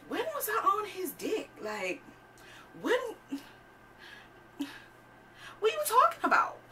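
very high, squeaky mock disbelief; high-pitched, hammed-up bewilderment; incredulous questioning. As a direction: squeak it out, baffled by the accusation. Acts out disbelief at accusation.

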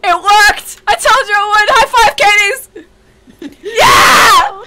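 high whoop of victory; jubilant cheer. Real, not acted. Player celebrating that the plan worked, jubilant cheering.

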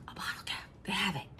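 gasp, then a hushed, thrilled whisper; excited whisper. Retold or imitated. Telling Jimmy about the bottle cap, excited whisper. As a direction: whisper it like a secret treasure find. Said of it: "I'm like".

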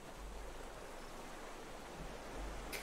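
breathy silent chuckle; silent laugh. Real, not acted. Laughs at the scarecrow.

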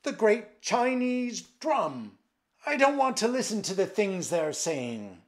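loud, high-pitched frantic command; shouted. A narrator's reading. Emperor refusing to listen to his deeds, shouting. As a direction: shout over what you refuse to hear. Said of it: "he shouted".

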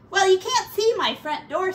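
high, loud chatty rat voice; Ratty greeting his new neighbor; cheery pack rat. Acted. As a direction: chirp it out proudly.

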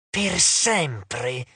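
a chorus of nymphs hissing; hissed. Acted. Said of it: "sibilarono le altre". (le ninfe sibilano "Per sempre")

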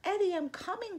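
high, urgent call. A narrator's reading. Mom hurries back to Eddie, calling out. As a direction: call across to a frightened child. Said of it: "called Mom".

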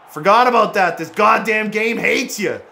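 loud, high complaint; exasperated outburst. Real, not acted. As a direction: rail at the game, drawing it out. Rails at the game.

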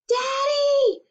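high calling shout. Acted. Emmy calling Daddy over the mower, high shout. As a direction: call out to him across the yard. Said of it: "she shouted".

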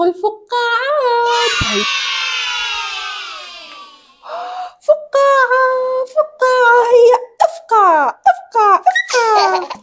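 put-on delight the baby with bubbles; excited baby talk.

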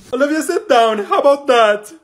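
high, loud teacher's order; scolding. Acted. Teacher telling Olivia to sit down, loud scolding. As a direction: call the student sharply to heel.

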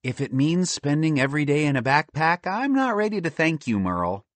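mildly huffy, high-pitched wife; slightly haughty, rising high; sniffy. Acted. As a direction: refuse thanks with a light huff. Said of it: "Pearl sniffed".

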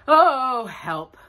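high loud oh, then a dropped help; mock dismay of a stuck bear; mild cry for help. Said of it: "said Pooh".

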